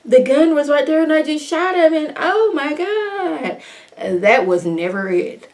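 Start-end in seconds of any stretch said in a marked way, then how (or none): 0.0-3.6 higher, hammed-up frightened babble; a hammed-up fake fright; mock scared. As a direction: pretend to be a panicky shooter. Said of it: "It wasn't like".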